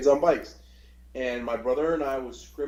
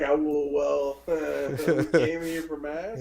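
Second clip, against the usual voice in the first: put-on slow, halting, laughing boy excuse; guilty son answering mom; sheepish kid; 3 semitones higher, 4 dB louder, much slower than usual. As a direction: stammer out the excuse. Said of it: "And I'm like".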